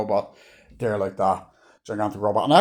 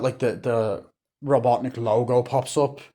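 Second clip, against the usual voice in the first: usual speaking voice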